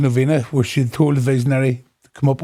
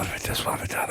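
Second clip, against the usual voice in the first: hushed, whispered inner voice; fretting under the breath in a whisper; whispered worrying; 12 dB quieter, much faster than usual. Retold or imitated. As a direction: whisper it like a nagging thought. Voicing constant anxious overthinking.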